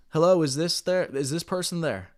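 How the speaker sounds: hesitant questioning greeting; timid asking. Shown as a demonstration. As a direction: ask like you doubt they're there. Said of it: "I don't ask".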